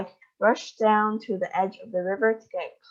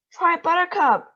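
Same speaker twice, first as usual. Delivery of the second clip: higher, louder eager call; excited cry; 9 semitones higher, 5 dB louder than usual. A narrator's reading. Wonka urging the guests on, excited cry. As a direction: cry out the invitation with glee. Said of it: "cried Mister Wonka".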